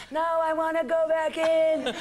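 a daughter yanked out by her lifeline, wailing with laughter; mock child scream. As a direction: ham up a dangling kid protesting.

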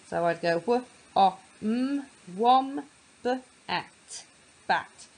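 w, o, m, wom said as split sounds, then blended; sounding out. Shown as a demonstration. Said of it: "I sounded out one syllable".

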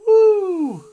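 shrill, very high, loud woo; Ric Flair woo. Retold or imitated. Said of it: "too many woos".

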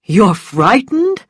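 drawn-out fake astonishment; feigned surprise. A narrator's reading. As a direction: pretend to be taken aback. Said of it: "He pretended to be surprised".

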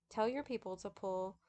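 lightly nasal, pinched through the nose; a touch of nasal twang as Spider. Acted. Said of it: "in his nasal voice".

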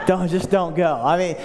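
usual speaking voice

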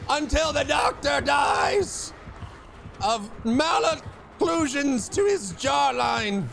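pumped-up gamer yelling at the killer; excited shout. Real, not acted. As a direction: shout it like you just escaped. Hyped gamer shouting mid-chase.

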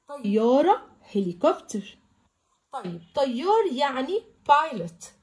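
normal talking voice

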